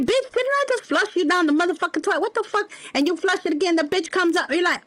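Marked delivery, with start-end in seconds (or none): 0.0-2.7 high, annoyed mock outrage; exasperated at the toilet. Talking to a turd that keeps floating back, high mock disgust. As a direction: act fed up with a turd that won't flush. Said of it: "And you're like".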